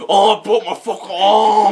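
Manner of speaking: loud, high mock wail of a hurt kid; imitated pained yell. Friend imitating a kid hurt in the pool, loud mock wail. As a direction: holler it as a hammed-up complaint. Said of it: "And he's like".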